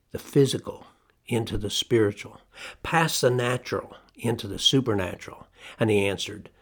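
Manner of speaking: normal talking voice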